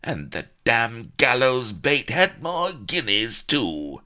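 high, loud, slow complaint; angry gamester complaining about stolen guineas. A narrator's reading. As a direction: grumble it from far off in the crowd. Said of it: "a distant voice could be heard complaining".

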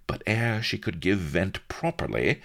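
plain reading voice

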